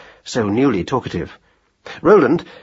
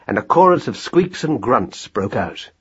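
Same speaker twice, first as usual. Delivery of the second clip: ordinary delivery, nothing marked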